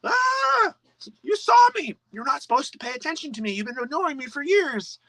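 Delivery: very high, squeaky cry of a caught shadow; startled shadow. Retold or imitated. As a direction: squeal like a little creature caught in the light. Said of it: "your shadow goes".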